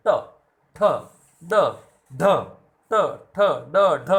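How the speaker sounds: ट ठ ड ढ said one at a time, tongue curled back and released; crisp classroom demo of ट ठ ड ढ; retroflex sounds demo. Shown as a demonstration. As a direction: sound out ट ठ ड ढ with spaced pauses. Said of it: "जैसे आप बोल के देखो ट".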